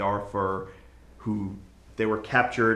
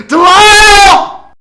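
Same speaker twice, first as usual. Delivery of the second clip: long, much higher and louder scream of Delisle; anguished cry; 19 dB louder, much slower than usual. Acted. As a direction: wail the name in anguish. Final anguished cry of name.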